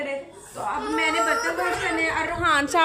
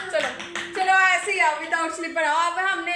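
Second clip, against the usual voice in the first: usual speaking voice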